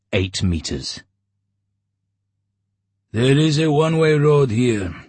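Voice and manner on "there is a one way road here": higher, louder border guard; gruff commander voice. A narrator's reading. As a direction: bark it as a guard.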